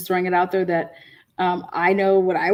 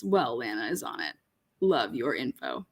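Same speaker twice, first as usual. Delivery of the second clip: low quiet reading voice; 9 semitones lower, 8 dB quieter than usual. Retold or imitated. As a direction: lower and softer, reading the comment. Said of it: "Willow says".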